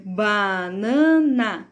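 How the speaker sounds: "banana" said very slowly as "ba-na-na", each syllable pulled apart; syllables split slowly. Shown as a demonstration. Teacher showing pupils three syllables, very slow. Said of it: "Palavras que contêm três sílabas".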